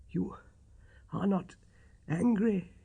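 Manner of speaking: breathless, broken-up question; gasping for breath. A narrator's reading. Sick Holmes anxiously asking Watson, gasping for breath. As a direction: fight for air between words. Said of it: "gasping for breath".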